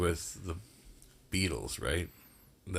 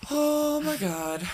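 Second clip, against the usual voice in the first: high drawn-out groan; much slower than usual. Real, not acted. Reacting to pun.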